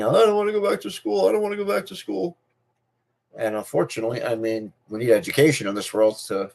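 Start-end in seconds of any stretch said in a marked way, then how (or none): 0.0-2.4 put-on high, tearful child whining about school; child crying. Kid begging his parents not to go back, tearful. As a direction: imitate your younger self whimpering, near tears. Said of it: "I used to come home crying".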